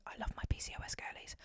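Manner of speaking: fast whispered shout-out; whispered aside. Real, not acted. Quick hushed shout-out to her PCOS viewers, whispered. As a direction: slip it in under your breath.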